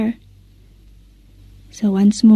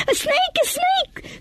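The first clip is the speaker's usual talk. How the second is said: terrified scream; 8 dB quieter than usual. Acted. Jason seeing the snake on Mary's neck, shrieking. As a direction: shriek the alarm. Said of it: "screamed Jason".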